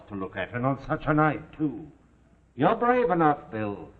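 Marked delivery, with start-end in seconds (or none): 2.5-3.5 louder, weight on brave; pointed praise. Acted. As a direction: lean hard into brave. Toff scolds his crew.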